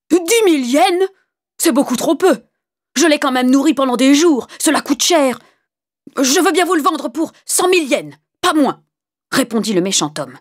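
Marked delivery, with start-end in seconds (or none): 0.1-8.8 high, loud, outraged protest; indignant haggling. Acted. Wicked man demanding more money for the dog, indignant. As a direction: push the price up with scandalised force.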